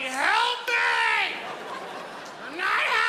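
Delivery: very high, loud mock shriek; imitated tantrum scream; screaming toddler. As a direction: scream for help like a kid in meltdown.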